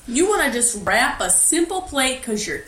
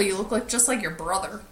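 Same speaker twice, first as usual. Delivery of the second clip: usual speaking voice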